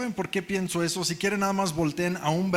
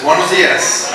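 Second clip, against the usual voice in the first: louder greeting; 13 dB louder than usual. Real, not acted. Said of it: "bien calladitos todos".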